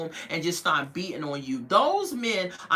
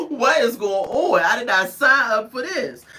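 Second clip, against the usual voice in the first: louder, alarmed and upset; pearl-clutching shock; 7 dB louder than usual. Retold or imitated. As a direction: gasp it out in dismay. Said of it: "clutching her pearls".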